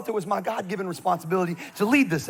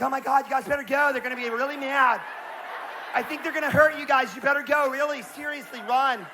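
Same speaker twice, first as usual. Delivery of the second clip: mock warning girls; 6 semitones higher than usual.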